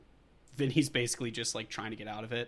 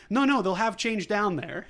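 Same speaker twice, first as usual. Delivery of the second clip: higher, louder imitated brush-off; hurried brush-off; 8 semitones higher, 7 dB louder than usual. Imitating Vinny leaving with Barney's pants, loud brush-off. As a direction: wave off the question in a raised voice. Voices man hurrying off with pants.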